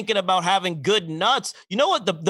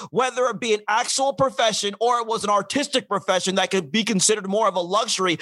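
ordinary talk, nothing marked

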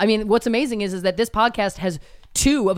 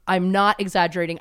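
ordinary talk

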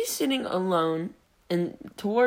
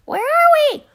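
much higher and louder, imitating her; squeaky high voice; 11 dB louder than usual. Kid mimicking a classmate's squeaky question. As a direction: squeak it like a mouse. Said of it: "she has a very high-pitched voice".

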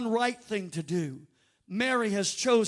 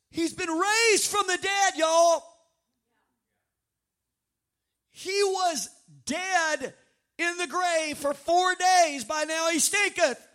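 high, loud, incredulous pulpit shout; raised, stretched-out preacher's cry; preaching at full force; 7 semitones higher, 6 dB louder, slower than usual. Real, not acted. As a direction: hammer it out slowly to the congregation.

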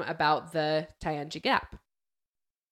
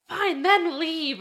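high, slow, louder dismissal; mock yell; 11 semitones higher, 5 dB louder, much slower than usual. Soldier dismissing the pilot over the radio, slow mock yell. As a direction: spit it out slowly in disgust. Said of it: "yells at him".

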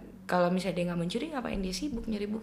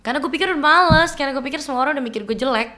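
excited; 5 semitones higher, 10 dB louder, faster than usual. Real, not acted. Excited retelling, voice rising.

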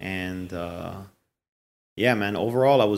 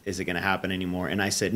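ordinary talk

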